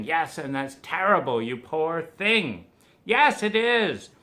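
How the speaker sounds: loud, high mock commiseration, wallowing; hammed-up pity party. Two friends wallowing over traffic and mother, loud and hammed-up. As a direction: overdo the sympathy like a melodrama.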